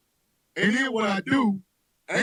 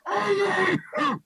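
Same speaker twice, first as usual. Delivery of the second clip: mock panic; 8 semitones higher, slower than usual. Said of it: "You're going".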